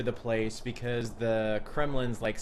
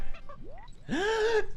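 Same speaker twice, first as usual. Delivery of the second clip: sharp high gasp of delight; excited gasp. Real, not acted. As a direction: gasp at the sight. Snake appears in game.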